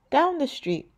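ordinary delivery, nothing marked

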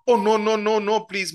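high, loud run of pleading no's; alarmed plea. Acted. Neighbour begging the quarrelling couple to stop, high alarmed plea.

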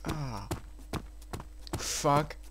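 ordinary talk, nothing marked

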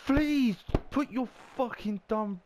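a high, loud plea that breaks off; desperate yell. Real, not acted. Gamer pleading with his striker, frantic high shout. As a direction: scream at the player to do it right. Begging at on-screen player.

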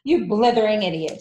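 loud, angry shouted insult; furious headmistress shout; Trunchbull. A narrator's reading. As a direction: hurl abuse at a pupil. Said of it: "shouted the Trunchbull".

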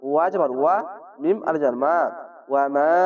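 ordinary talk

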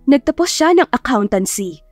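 usual speaking voice

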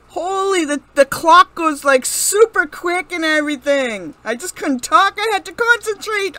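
loud, keyed-up explanation; excited rush. Real, not acted. Gamer explaining the fast clock, loud and excited. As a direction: still buzzing from the round.

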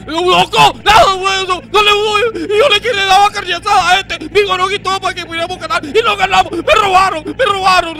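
high furious yelling rant; angry shouting. Acted. Fan ranting after losing game.

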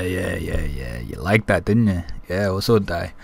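ordinary talk, nothing marked

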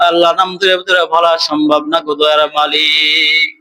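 ordinary talk, nothing marked

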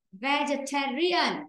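Vegetarian, weak ve, stress on ta, slow; stress on third syllable. Shown as a demonstration. Teacher showing where the stress falls in the word. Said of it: "âm thứ nhất là âm nhẹ".

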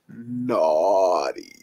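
low, soft, drawn-out "naughty"; mock-seductive creep; creepy innuendo. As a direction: stretch it suggestively, dropping right down. Creep's suggestive last word.

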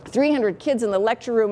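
usual speaking voice

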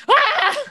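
high, short acted scream; mock scream. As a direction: scream at him in mock fright. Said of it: "I'll scream at him too".

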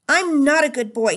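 put-on a boy finally snapping at his teacher; screaming child. A narrator's reading. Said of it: "he screamed".

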